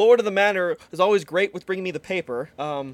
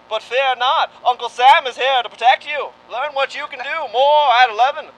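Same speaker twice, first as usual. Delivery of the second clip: loud, higher newsreel announcer; dramatic announcer; 5 semitones higher, 6 dB louder than usual. Acted. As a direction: boom it like a wartime newsreel. Mock wartime news bulletin.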